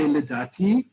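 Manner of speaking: LIDATI lifted at the end, like a tentative opinion; humble rising intonation. Shown as a demonstration. Teacher showing how to soften an opinion, LIDATI rising humbly. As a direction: let LIDATI go up a little at the end. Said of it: "show a little bit of humility".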